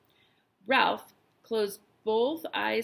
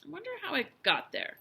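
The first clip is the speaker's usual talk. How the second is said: higher, lightly voiced boy; a hint of puzzled boy; boy talking to himself; 6 semitones higher than usual. A narrator's reading. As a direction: muse to yourself in a higher voice. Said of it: "said the boy to himself".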